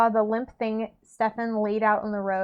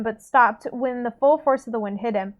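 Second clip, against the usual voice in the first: usual speaking voice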